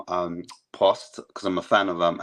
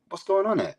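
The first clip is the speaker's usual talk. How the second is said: high, incredulous mock complaint; exasperated disbelief; 12 semitones higher than usual. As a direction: throw up your hands at the club. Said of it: "you're like".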